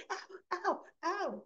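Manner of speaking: high, softish yelps of pain; imitated pain. Heather hurting as she tries to stand, imitated pain. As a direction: act out a mock "ouch" while trying to rise.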